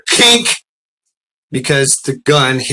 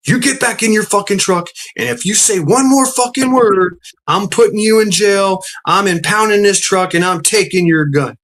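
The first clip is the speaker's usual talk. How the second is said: high, forceful retold cop's tirade; imitating an irate trooper; angry threat; 7 semitones higher than usual. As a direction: lay down the law, punching each threat. Retold trooper threatening him in face-off.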